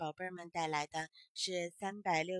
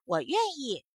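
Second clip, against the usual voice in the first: grasshopper agrees; 3 semitones higher, 8 dB louder than usual. Acted. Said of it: "小蚂蚱说".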